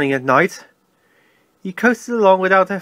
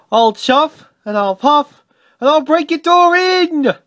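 higher ghostly threat, building to a wail; spooky threat; 10 semitones higher, 4 dB louder than usual. A narrator's reading. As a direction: play the big bad wolf as a wailing ghost. Ghost threatens like big bad wolf.

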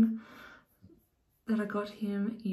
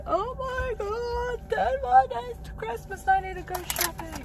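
much higher, slow panicked shopper voice; mock the Christmas rush in a squeaky panic; frantic shopper; 13 semitones higher than usual. Mocking busy Christmas shoppers, squeaky and panicked. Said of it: "everyone's probably like".

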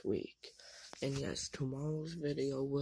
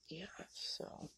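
hushed whisper so nobody wakes; whisper, people still asleep; 3 semitones higher, 7 dB quieter than usual. Real, not acted. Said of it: "I'm whispering because people are still sleeping".

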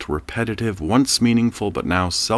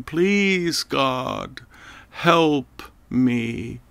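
desperate wail; 7 semitones higher, much slower than usual. A narrator's reading. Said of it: "he wailed again".

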